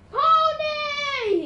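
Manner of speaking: high, loud cry of pure delight; rapturous cry. A narrator's reading. Tyson seeing a centaur, crying out in rapture. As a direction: shriek it in total rapture. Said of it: "he cried in total rapture".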